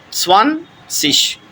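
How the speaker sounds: سوانسش very slow and high, split in two; drawn-out, high-pitched demo of سوانسش. Shown as a demonstration. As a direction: break سوانسش after سوان and end on sh. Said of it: "یہ شین کی آواز دے گا".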